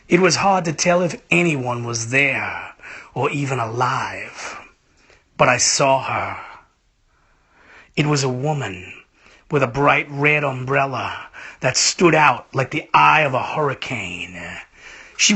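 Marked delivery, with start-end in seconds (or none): none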